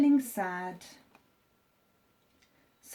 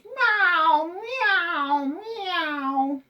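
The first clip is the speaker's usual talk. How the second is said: high, louder meows fading down; cat meowing; 9 semitones higher, 6 dB louder, much slower than usual. A narrator's reading. Cat in the car meowing in protest. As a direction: meow over and over like a cat. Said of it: "She meowed and meowed".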